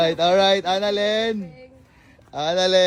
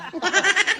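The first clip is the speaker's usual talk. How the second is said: delighted laugh; 8 semitones higher than usual. Real, not acted. Laughing at grandma's hiding place.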